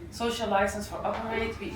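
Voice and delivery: ordinary talk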